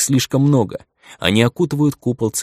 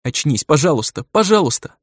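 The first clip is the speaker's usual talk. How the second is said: tearful pleading; 6 semitones higher than usual. Acted. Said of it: "Дрожащим от слёз голосом".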